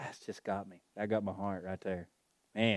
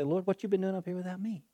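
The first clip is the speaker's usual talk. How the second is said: much higher bantering voice; playful retort; 11 semitones higher, 4 dB louder, faster than usual. Retold or imitated. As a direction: tease right back, high and light. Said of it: "I say".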